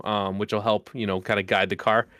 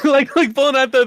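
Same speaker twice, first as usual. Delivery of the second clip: laughing; 13 semitones higher, 7 dB louder than usual. Real, not acted. Laughing at the butcher story.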